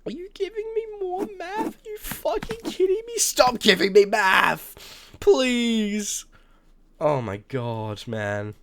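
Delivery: high, loud, drawn-out pleading yell; desperate scream. Real, not acted. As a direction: beg them to stop, screaming. Screaming about being given math.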